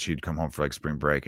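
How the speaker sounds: ordinary delivery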